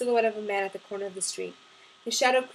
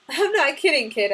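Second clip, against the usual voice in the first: lightly teasing, pitched up; joking; 4 semitones higher, 6 dB louder than usual. A narrator's reading. Sarah teasing her young neighbor, joking. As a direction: give it a playful, joking lift. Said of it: "I joked".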